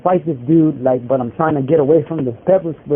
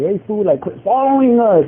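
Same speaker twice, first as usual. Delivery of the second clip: joking taunt; 7 semitones higher than usual. Retold or imitated. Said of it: "And we're laughing".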